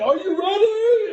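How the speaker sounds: high, loud, drawn-out holler; wild imitated battle cry; Dennis Rodman yelling. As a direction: stretch the last word into a big yell. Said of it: "he just kept yelling".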